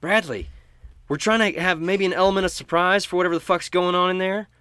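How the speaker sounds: loud, high furious telling-off; angry scolding. Acted. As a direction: yell at him furiously. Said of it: "she says".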